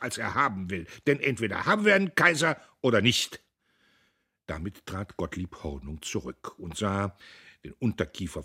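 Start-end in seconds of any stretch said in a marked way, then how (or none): 1.1-3.4 rousing speech. A narrator's reading. Hornung ends a fiery speech.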